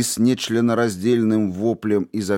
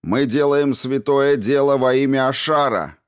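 raised, fervent rallying shout; shouted back; 6 semitones higher, 4 dB louder than usual. A narrator's reading. Man in the mob proclaims holy work, shouting. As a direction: call it out high, like a zealot. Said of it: "крикнул кто-то в ответ".

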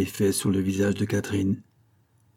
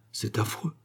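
murmured; 7 dB quieter than usual. A narrator's reading. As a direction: let it out under your breath. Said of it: "murmura Catherine". (dans un murmure)

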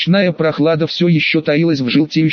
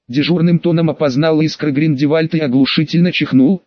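ordinary delivery, nothing marked

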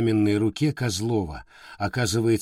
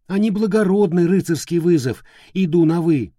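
louder, higher, heated insistence; fierce urging; 5 semitones higher, 4 dB louder than usual. Acted. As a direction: push hard, rising indignation. Character urges crushing enemy.

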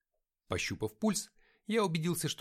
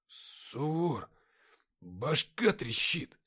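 mumbling; 3 semitones higher, much slower than usual. A narrator's reading. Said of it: "забормотал Никита".

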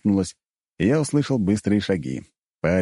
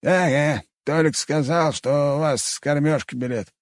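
higher put-on old man voice; old cook voice; 8 semitones higher than usual. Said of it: "пояснил Кантемирыч".

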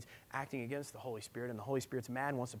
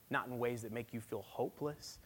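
usual speaking voice

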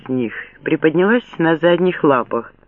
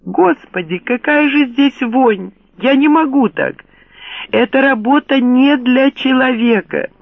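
hysterical shriek; 9 semitones higher than usual. Acted. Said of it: "пронзительный, истеричный визг". (истеричный визг, высоко и пронзительно)

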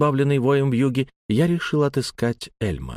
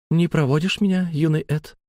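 higher, lightly voiced woman's invitation; singer's gentle invitation; 5 semitones higher than usual. A narrator's reading. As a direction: ask the boy lightly, voice lifted. Певица зовёт мальчика проводить.

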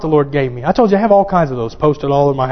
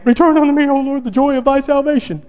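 high pleading cry to God; acted prayer of a man without joy; joyless plea; 8 semitones higher than usual. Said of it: "that joy wasn't there right then".

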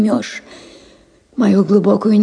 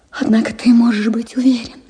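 ordinary delivery, nothing marked

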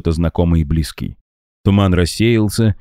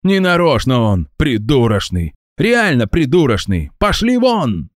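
high scornful ranting; angry scorn; 8 semitones higher than usual. A narrator's reading. Angry man scorning Max's excuse, high ranting. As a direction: sneer and then chase them off.

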